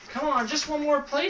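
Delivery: put-on high, eager shout of a little boy. A narrator's reading. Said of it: "shouted Eli".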